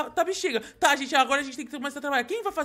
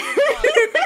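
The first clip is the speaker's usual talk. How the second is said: high, loud burst of laughter; real laugh; 11 semitones higher, 6 dB louder than usual. Real, not acted. Player laughs at the glitched giant neck, amused.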